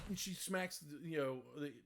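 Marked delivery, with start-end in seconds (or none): none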